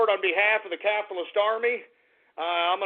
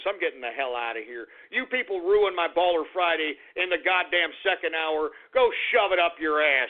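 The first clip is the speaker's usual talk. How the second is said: usual speaking voice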